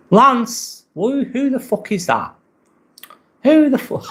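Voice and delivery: high-pitched disbelieving yelp at a name; incredulous outburst. Real, not acted. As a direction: squeal it out, baffled. Baffled outburst at odd name.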